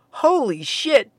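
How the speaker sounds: stunned at the sight of it; stammered. A narrator's reading. Jeremy seeing a talking Sasquatch, stammering in shock. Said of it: "Jeremy stammered".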